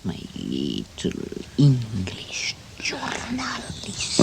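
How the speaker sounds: soft, slow whisper; affectionate whisper; tender whisper. Real, not acted. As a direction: murmur it fondly. Tender moment with husband.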